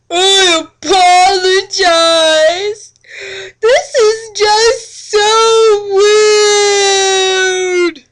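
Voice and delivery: loud, high crying apology; hammed-up sobbing. A narrator's reading. Vampire apologizing to Tia, hammed-up loud sobbing. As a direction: sob it out in mock anguish.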